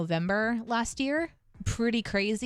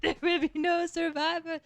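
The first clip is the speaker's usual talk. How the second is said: higher, playful copy of the French pirate line; imitating the French accent; 10 semitones higher than usual. Friend echoing the French pirate line, mock accent.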